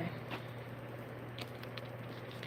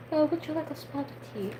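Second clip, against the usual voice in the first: higher, softer try at British; tentative mock-British voice; attempted British accent; 8 semitones higher, 8 dB quieter than usual. Shown as a demonstration. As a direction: quietly test out a British accent. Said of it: "a British accent is, like, really hard for me".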